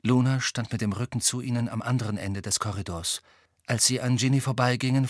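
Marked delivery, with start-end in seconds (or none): none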